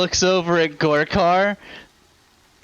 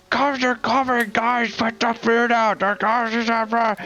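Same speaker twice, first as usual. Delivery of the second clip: mild mock yell; 5 semitones higher than usual. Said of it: "Like, yelling something".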